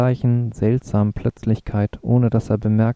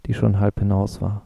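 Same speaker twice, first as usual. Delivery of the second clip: ordinary delivery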